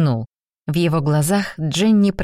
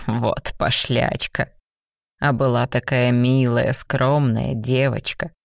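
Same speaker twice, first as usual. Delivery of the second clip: slow, lower playful tease; low teasing demon; 4 semitones lower than usual. A narrator's reading. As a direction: tease her lazily.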